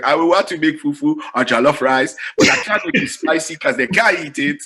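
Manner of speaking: lightly voiced African mother-in-law, pitched a little up; a hint of an African accent. Wife's mother offers to cook for the guest, lightly imitated African accent. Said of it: "my African mother-in-law".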